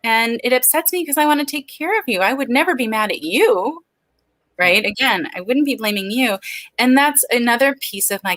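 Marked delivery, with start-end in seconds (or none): 0.0-3.8 a hint of mommy voice, higher; parent reassuring child. Mother telling her child she wants to take care, lightly acted reassurance. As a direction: gently reassure your kid, lightly acted. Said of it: "when you talk to your kids".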